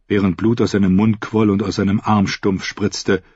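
usual speaking voice

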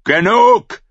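the king bellows to silence the quarrel; shouting. A narrator's reading. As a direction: cut them all off. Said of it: "Schrie Stannis".

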